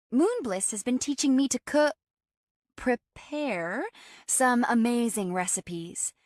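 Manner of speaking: high, upbeat and enthusiastic; bright. A narrator's reading. Friend tells housemate about her cooking lessons, brightly. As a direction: share it brightly, pitch up. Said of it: "Demi told me brightly".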